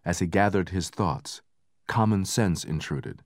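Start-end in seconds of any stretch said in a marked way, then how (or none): none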